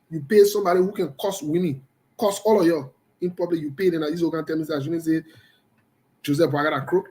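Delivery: ordinary talk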